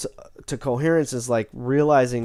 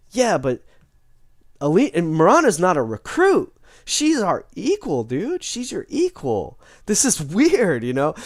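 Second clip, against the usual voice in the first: exasperated objection; 6 semitones higher than usual. Retold or imitated. Jermaine argues with Titus.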